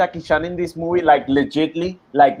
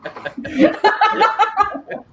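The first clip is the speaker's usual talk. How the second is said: high burst of laughter. Real, not acted. Friend laughing at a cheeky Mushu joke, high cackle.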